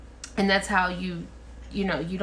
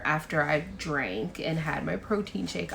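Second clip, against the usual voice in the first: normal talking voice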